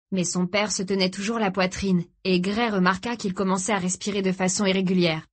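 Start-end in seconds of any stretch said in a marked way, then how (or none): none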